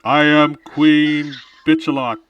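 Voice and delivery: loud mock empress voice; majestic queen. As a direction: proclaim it like a haughty queen. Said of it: "You sound majestic".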